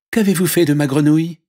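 high, quick demand; princess questioning. Acted. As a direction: anxiously question a stranger. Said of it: "interrogea la princesse". (question aiguë et rapide de princesse)

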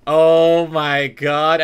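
loud, long drawn-out exclamation; slow, overblown horror; dramatic dismay. Pretend. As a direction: stretch it out in stunned disbelief. Overblown shock at realization.

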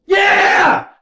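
short high, loud cheer; real gambler's victory shout; triumphant yell. Real, not acted. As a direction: burst out cheering. Celebrating a card.

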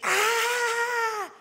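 long, high sustained shriek. A narrator's reading. Impure spirit leaves the man with a long shriek. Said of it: "came out with a shriek".

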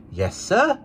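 put-on high little girl voice; young girl. A narrator's reading. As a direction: answer like a trusting girl. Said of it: "returned the child".

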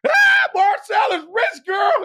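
high, loud mock shriek with a drawn-out start; retold excited scream. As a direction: imitate a bank teller screaming the news. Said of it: "starts screaming in the bank".

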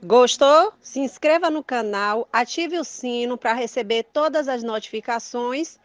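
loud, upbeat subscribe plea; YouTube outro. Acted. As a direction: announce it like a channel sign-off. Channel outro call to action. (chamada animada de fim de vídeo)

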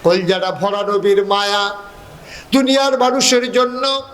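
ordinary talk, nothing marked

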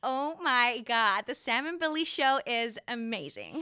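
slow, drawn-out American gush; American accent. Shown as a demonstration. Guest copying the host's line on request, American accent. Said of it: "Um, American".

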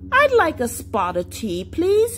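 put-on playful British accent, rising very high on the last word. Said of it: "I just put on a British accent".

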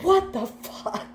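high, slow, stunned parent voice; a parent staring at a wrecked bathroom; exasperated parent. Acted. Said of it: "Like, that's the energy".

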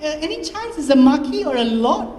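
high, gushing coo, giggly; cooing with excitement. Acted. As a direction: swoon over the romance. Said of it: "cooed with excitement".

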